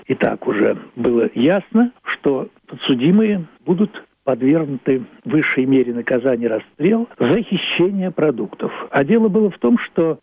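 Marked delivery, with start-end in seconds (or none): none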